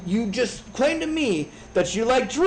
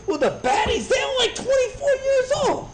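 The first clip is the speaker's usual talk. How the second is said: mock shriek. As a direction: squeal it in a far higher, put-on voice. Rant bit, shrieked punchline.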